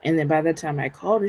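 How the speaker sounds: ordinary talk, nothing marked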